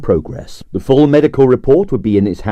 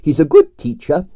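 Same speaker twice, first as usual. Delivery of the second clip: put-on Russian accent with a smile; a touch of smiling Russian charm; 5 semitones higher than usual. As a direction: say it smiling, in her Russian lilt. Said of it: "smiled Arienskaya".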